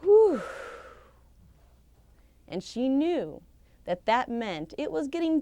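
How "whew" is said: high, loud blown-out whew; sighing whew. A narrator's reading. Old husband puffing at the heat, a loud whew. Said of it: "she heard a sound of".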